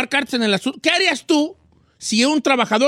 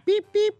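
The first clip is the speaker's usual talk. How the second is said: mock beeping; 10 semitones higher than usual. Silly machine noise on a name.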